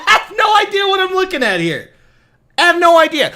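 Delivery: high-pitched, laughing disbelief; real incredulous laughter. Real, not acted. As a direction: crack up while saying it.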